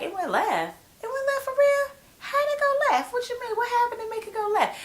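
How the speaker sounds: squeaky high disbelieving questions piling up; mock baffled husband. Retelling the show, husband asks Cynthia in mock high disbelief. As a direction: ham up the clueless husband, way up high. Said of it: "And he's like".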